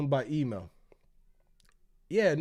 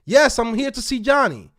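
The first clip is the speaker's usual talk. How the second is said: louder, pushier repeat; loud and firm; 6 semitones higher, 11 dB louder than usual. Retold or imitated. To the doubting receptionist, louder and firmer. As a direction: plant your feet and insist. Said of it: "So now I gotta talk like this".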